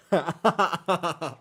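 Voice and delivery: loud, high burst of laughter. Real, not acted. Streamer amused by his hidden joke, real laughing.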